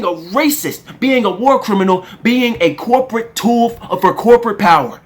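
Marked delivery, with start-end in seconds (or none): none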